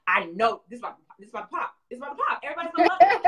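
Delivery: high, loud hyped-up boast, laughing; confident artist. Retold or imitated. Artist hyping her own product, high and laughing. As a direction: sell it like it's about to blow up. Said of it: "you're like".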